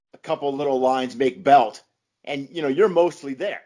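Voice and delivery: ordinary talk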